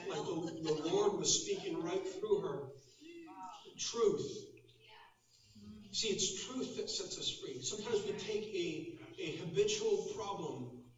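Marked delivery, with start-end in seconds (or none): none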